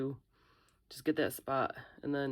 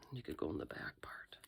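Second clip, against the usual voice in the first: whispering; 10 semitones lower, 10 dB quieter than usual. Real, not acted. Careful demolding, whispers.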